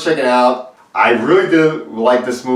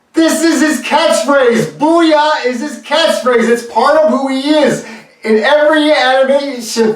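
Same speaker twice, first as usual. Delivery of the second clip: indignant; 12 semitones higher, 3 dB louder than usual. Real, not acted. Fan protesting the Snyder Cut change, indignant. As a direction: lay it out like it's obvious. Indignant rant about the catchphrase.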